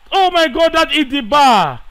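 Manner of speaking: high, loud burst at the near miss; yelling as the ball hits the bar; excited shout. Real, not acted. Gamer yelling at a shot off the bar, excited.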